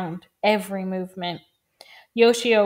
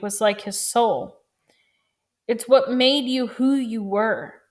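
ordinary delivery, nothing marked

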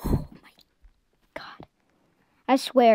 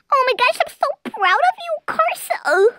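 gushing praise; 12 semitones higher, 7 dB louder than usual. Real, not acted. Playful mock praise.

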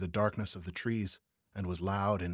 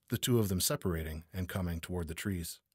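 ordinary delivery

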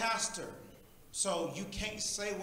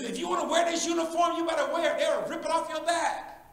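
louder and higher, hammering it home; shouting; 7 semitones higher, 7 dB louder than usual. Acted. Preacher shouting at the congregation like an angry coach. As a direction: roar it like a coach in the locker room.

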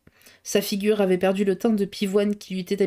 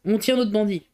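ordinary delivery

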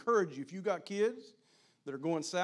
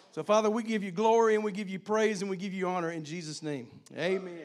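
winding down prayer; 6 dB louder than usual. Acted. Closing prayer.

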